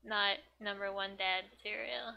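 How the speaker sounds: ordinary delivery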